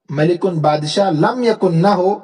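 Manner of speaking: ordinary talk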